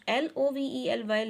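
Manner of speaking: ordinary talk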